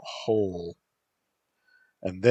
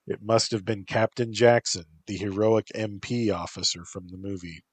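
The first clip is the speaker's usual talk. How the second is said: ordinary delivery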